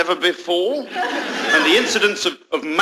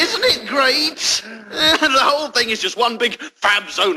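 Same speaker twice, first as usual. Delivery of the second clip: gushing; 3 semitones higher, slower than usual. Acted. Spokesman raving about the Dome, gushing. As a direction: gush slowly with overblown delight.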